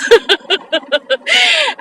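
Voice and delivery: high-pitched burst of laughter; real laugh. Real, not acted. Woman joking about her past husbands, laughing. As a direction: laugh at your own joke.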